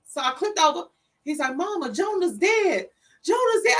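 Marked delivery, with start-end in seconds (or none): 1.6-3.8 high, rising urgency as it repeats; retold panicked news. Older son repeats to his mother that Jonah is dead, retold panic. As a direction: insist on the terrible news, pitch climbing.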